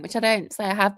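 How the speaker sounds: ordinary talk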